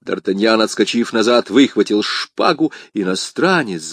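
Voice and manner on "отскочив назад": ordinary delivery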